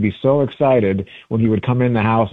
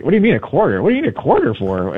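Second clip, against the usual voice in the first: higher-pitched mock puzzled youngster; imitated bafflement; 5 semitones higher, faster than usual. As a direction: play someone who has never heard of it. Voices baffled young listener.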